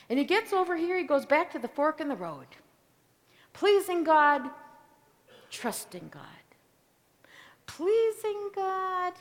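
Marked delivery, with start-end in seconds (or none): 3.5-4.5 pleasing God called out loud and high; loud, raised pleasing God; emphatic. Shown as a demonstration. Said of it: "preacher contrasts the two paths". 5.5-6.4 trusting God dropped to a low whisper; hushed. Shown as a demonstration. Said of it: "preacher contrasts the two paths". 7.7-9.1 Pleasing God stretched out slow and high; slow, lifted pleasing God; drawn-out, high. Shown as a demonstration. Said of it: "preacher repeats the choice".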